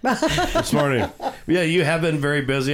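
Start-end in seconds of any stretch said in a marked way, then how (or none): 0.0-1.1 high, slow, laughing echo; drawn-out playful tease; teasing echo. Real, not acted. Teasing echo of partner's words.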